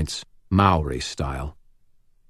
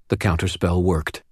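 usual speaking voice

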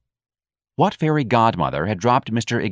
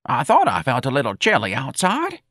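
put-on high, dotty elderly voice; wheezy old woman; 6 semitones higher than usual. A narrator's reading. Elderly employee explains her nightgown to manager, dotty. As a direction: play a confused old lady, pitched up.

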